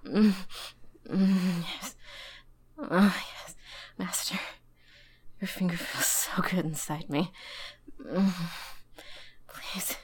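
breathless begging. Acted. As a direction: beg with rising urgency.

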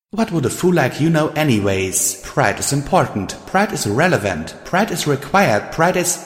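indignant, high-pitched insistence; angry. A narrator's reading. Pride defending herself to Radiant Star, angry. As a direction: build up an offended tirade. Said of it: "she said angrily".

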